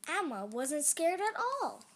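usual speaking voice

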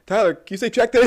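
loud high call to a star; fan shouting. Pretend. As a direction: yell across the crowd. Fan calling at a concert.

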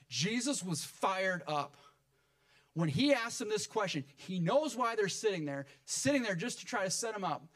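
high, impassioned preacher's delivery; fired-up preaching. Real, not acted. Preacher telling church of Jesus confronting his accusers, fired-up preaching.

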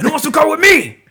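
high, loud rallying cry; rally the troops like a hammy action hero; rousing movie speech. Retold or imitated. Podcaster reenacting Van Damme rallying troops, loud and high. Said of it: "that speech".

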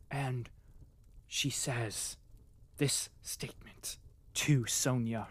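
whispered gossip. Real, not acted. Vlogger sharing juicy romance news, whisper. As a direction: hush it like a secret. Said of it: "whispered gossip".

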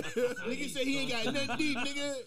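loud high laughing mockery; mock him while laughing. Real, not acted. Mocking friend, laughing.

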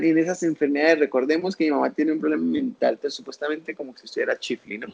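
ordinary delivery

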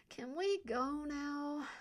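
high, dragged-out whine; whining. A narrator's reading. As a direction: stretch it out in a whiny plea. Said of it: "Elodie whined".

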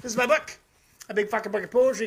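usual speaking voice

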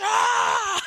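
high, loud scream of triumph; fan's victory scream. Acted. Sports fan cheering a touchdown, screaming. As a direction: scream like your team just scored. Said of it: "they start screaming".

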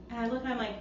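ordinary delivery

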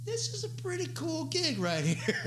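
squeaky high, carefree voice; a hammered young guy on a beach in Mexico, delighted and high. Retold or imitated. Drunk on the beach, loving tour.

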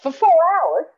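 high, loud incredulous question; retold disbelief. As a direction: re-enact being astonished by the answer. Retells her disbelief at Brad.

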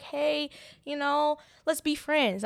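mock friendly offer. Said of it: "I didn't say".

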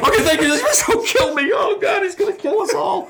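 high, loud hammed-up terrified wail; mock terror. Reliving the meeting with the angry star, a high, loud hammed-up terrified wail. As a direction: wail like he's about to kill you.

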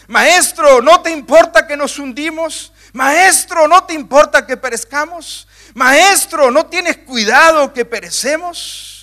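high, loud reproach, each Maestro pressed; reproachful cry. Acted. Preacher voicing disciples reproaching Jesus, high and loud. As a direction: accuse him while begging for help. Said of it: "tono de reproche".

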